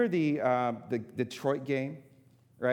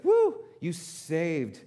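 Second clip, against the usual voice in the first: excited cheer; 4 semitones higher, 6 dB louder, much slower than usual. Retold or imitated. Cheering a saved soul.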